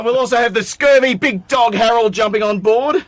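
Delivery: loud, high hammed-up pirate captain. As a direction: announce it like a swaggering pirate. Pirate-style guest introduction.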